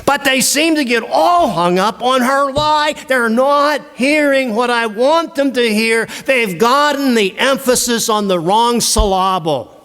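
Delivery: high, pleading writer's lament; a hammed-up complaining writer; exasperated author. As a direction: whine as the frustrated author.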